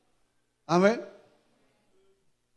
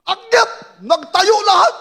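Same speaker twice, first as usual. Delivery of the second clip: high shouted charge; rally the men upward; battle cry; 6 semitones higher, 3 dB louder, slower than usual. Retold or imitated. Retold charge.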